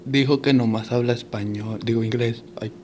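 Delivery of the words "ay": usual speaking voice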